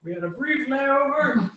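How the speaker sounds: high, slow eerie spirit voice. Acted. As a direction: drawl it high and ghostly. Continues spirit voice.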